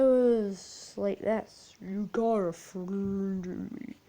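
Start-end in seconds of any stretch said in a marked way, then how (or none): 1.8-4.0 a hint of a demonic toy, dragged out. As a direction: sing it like a possessed toy, slowly. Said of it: "Sounds like a really demonic voice".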